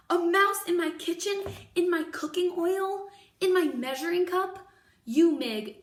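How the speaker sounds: alarmed cook. Acted. Cook panics at mouse.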